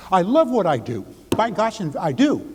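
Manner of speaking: high, bright-spirited declaration with real gusto; enthusiastic affirmation. Shown as a demonstration. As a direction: say it like you mean every word. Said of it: "put your emotions into it".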